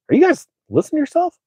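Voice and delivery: very high, quick mock disbelief; high-pitched incredulous question; incredulous retort. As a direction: squeak out an exasperated comeback. Retold incredulous retort to card companies.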